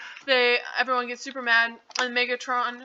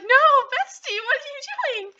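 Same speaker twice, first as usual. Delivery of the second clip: high-pitched, hammed-up panic over a friend; mock freaking out; 10 semitones higher than usual. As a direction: wail in comic distress. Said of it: "he's, like, freaking out".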